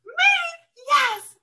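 overjoyed at being asked to sing; gasping delight. Retold or imitated. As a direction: gasp then very high, thrilled Me? Yes. Thrilled invitation to sing.

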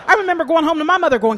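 normal talking voice

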